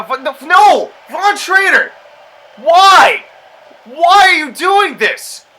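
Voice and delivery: high, loud shouting "no" and "why"; anguished shout. Real, not acted. Gamer shouting at Von Schrader for walking away. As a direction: scream at the screen in dismay.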